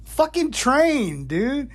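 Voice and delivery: high, slow exasperated groan of realisation; exasperated drawl. Retold or imitated. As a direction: stretch it out, fed up.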